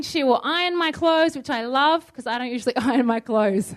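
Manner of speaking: ordinary talk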